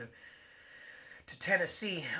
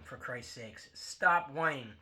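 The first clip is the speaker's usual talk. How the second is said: ordinary talk, nothing marked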